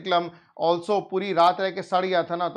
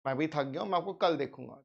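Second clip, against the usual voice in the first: quick, soft polite brush-off; जल्दी में विनम्र मना करना; polite refusal; 6 dB quieter, much faster than usual. Retold or imitated. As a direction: rattle off a tired, polite no. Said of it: "I refused politely by saying".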